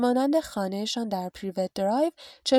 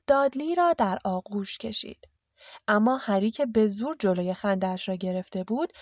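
ordinary delivery

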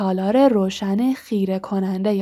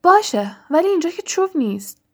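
higher, frantic cry of باشه; anxious Hermione crying out, higher; shout; 8 semitones higher than usual. A narrator's reading. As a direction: yell it back in a panic. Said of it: "فریاد زد".

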